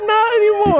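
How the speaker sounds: high squeal through real laughter; laughing. Real, not acted. Repeating the dark punchline to friends, laughing. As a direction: barely get it out while cracking up.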